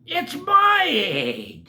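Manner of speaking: high, slow, spluttering bird; high, slow, sputtering. Acted. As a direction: sputter it out indignantly. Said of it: "she sputtered".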